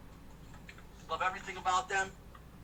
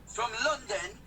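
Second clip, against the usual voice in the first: put-on English accent, higher pitched and drawn out; mock English accent; 6 semitones higher than usual. Teasing the English caller about London, mock English accent. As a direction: tease with an exaggerated English accent. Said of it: "start talking like I have an English accent".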